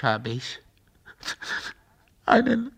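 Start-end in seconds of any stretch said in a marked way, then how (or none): 2.2-2.7 higher, louder desperate plea; pleading. Acted. Broken father voicing his only wish, pleading cry. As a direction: beg for one last wish.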